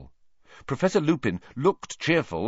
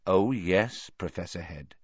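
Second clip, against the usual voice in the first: savouring oily Peeves; 3 semitones lower than usual. A narrator's reading. Peeves holds back his bombshell from Dumbledore, savouring. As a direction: relish the secret you are holding. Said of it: "air of one cradling a large bombshell".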